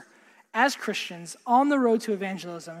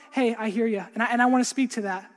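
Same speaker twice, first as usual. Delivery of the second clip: ordinary talk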